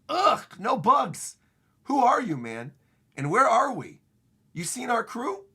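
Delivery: high, loud acted revulsion; recoil, then fire off questions; hammed-up grossed-out questioning; disgusted demanding.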